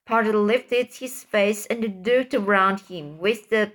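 plain reading voice

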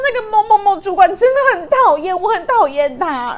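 high, loud, half-laughing mock sob; drunk crying. Drunk coworker crying about his boss, high laughing wail. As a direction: whine through laughter like a weepy drunk. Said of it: "他就开始哭".

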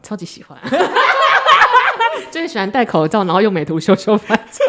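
giggling through the words; laughing. Real, not acted. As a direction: laugh while confessing. Joking about loving masks.

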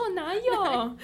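high, loud indignant protest; indignant denial. Retold or imitated. Protesting innocence retold.

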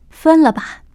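acted, emphatic urging; insistent outburst. A narrator's reading. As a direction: burst out with the demand. Bajie insists on splitting up.

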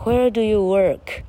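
slow, high "Where do you work" with work stretched; teacher's model, louring out the r in work; long vowel demonstration. Shown as a demonstration. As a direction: draw out "er" in work, crisp k at the end. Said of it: "而且拉长音".

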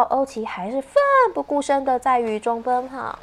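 ordinary delivery, nothing marked

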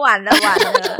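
loud laughing "it's over"; laughing panic. Retold or imitated. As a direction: laugh through the mock despair. Retold inner panic, laughing.

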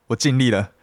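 ordinary delivery

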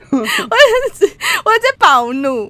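loud, slow, high outraged; furious. Real, not acted. Telling the co-host how angry she was, furious. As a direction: let the fury show.

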